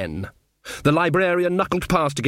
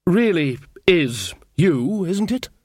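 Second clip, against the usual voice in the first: tender wonder; 5 semitones higher, slower than usual. Acted. Ridcully to Granny, slow and tender. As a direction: linger on every word. Old lovers reunited.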